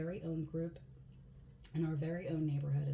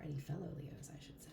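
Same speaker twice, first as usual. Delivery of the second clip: whispering; 2 semitones lower, 7 dB quieter than usual. Real, not acted. Aside to fellow Leos.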